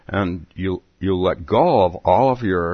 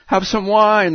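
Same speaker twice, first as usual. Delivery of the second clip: high, coaxing March Hare; March Hare offering wine, high; encouraging tone; 13 semitones higher, 4 dB louder than usual. Acted. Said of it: "in an encouraging tone".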